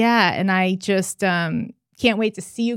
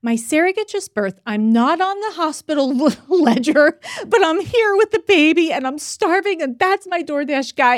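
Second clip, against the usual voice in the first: high-pitched, flustered retold explanation; awkward explaining; 8 semitones higher, 4 dB louder than usual. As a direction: plead innocence, amused and worked up. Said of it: "I have to awkwardly explain".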